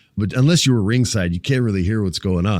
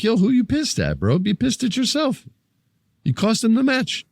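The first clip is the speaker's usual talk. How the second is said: high, pitched-up mock scold at the twins; mock scolding yell; 13 semitones higher than usual. Fan scolding the twins for costing Roman, high mock jeer. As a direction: jeer at them like a heckling fan.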